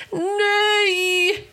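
high, long villain's roar. A narrator's reading. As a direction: roar out in protest. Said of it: "vrålade Helgerån".

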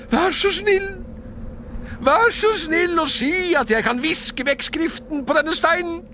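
high, loud, fervent begging; desperate pleading. A narrator's reading. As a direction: beg as if for your life. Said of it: "ropte Scrooge". (høylytt, desperat bønnfalling)

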